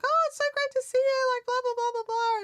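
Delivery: high, loud, gushing greeting; squeaky mock-enthusiastic babble; gushing chatterer. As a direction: imitate an over-friendly chatterbox at a high pitch. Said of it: "chatting and chatting and chatting".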